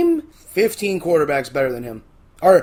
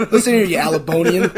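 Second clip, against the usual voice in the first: loud, hammed-up Alabama accent; super-Alabamian accent; 6 dB louder than usual. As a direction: play up a mock Southern accent through laughter. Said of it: "I go super Alabamian".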